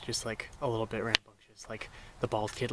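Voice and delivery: ordinary talk, nothing marked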